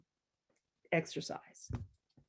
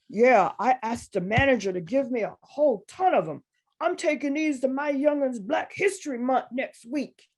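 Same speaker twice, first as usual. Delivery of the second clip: proud country guy; 5 semitones higher, 6 dB louder than usual. Retold or imitated. Retelling the proud bookmark guy.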